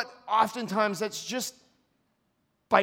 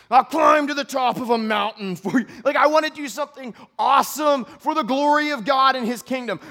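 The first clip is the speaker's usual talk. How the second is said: eager boast; 4 semitones higher, 6 dB louder than usual. Acted. Preacher acting eager self.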